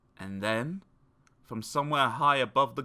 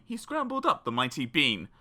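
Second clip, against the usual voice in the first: usual speaking voice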